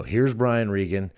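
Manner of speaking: ordinary talk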